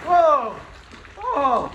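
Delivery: loud startled whoa; surprised shout. Real, not acted. Surprise at splash.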